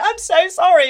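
loud high frantic apology. Retold or imitated. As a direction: blurt it out in panic. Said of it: "And I went".

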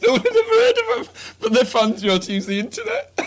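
laughing. Real, not acted. Laughing through a funny story.

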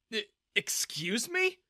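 stammering in disbelief; shocked. A narrator's reading. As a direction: caught off guard, stuttering. Stunned by the insult.

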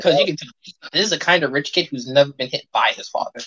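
usual speaking voice

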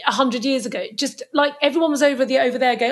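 ordinary talk

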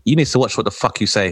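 usual speaking voice

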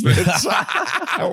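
high laughing yelp; laughing shout. Real, not acted. Joking Irish dancers beat them, laughing shout.

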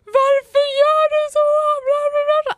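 very high, loud mock wail; crying and screaming. Retelling own breakdown on the floor, wailing scream. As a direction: scream it out like a hammed-up sob. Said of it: "gråter och skriker".

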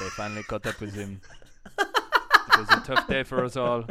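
mock-solemn French accent. Improvising sad news to a friend, in French accent. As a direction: finish the tale as a comic Frenchman. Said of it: "when [name] does the French accent".

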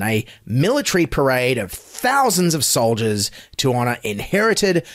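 ordinary delivery

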